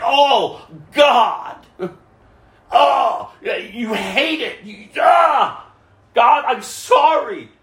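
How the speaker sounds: a remorseful plea, half shouted; heartfelt repentant cry. Retold or imitated. Preacher acts out a sinner crying out to God in remorse. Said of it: "It's like".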